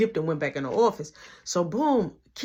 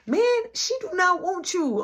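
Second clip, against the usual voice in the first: mock indignant; 11 semitones higher than usual. Teller's retorting himself.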